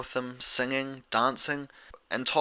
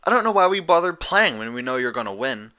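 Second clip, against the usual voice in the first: snarky; 3 semitones higher, 6 dB louder than usual. A narrator's reading. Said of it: "Dash snarked".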